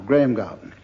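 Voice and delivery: ordinary delivery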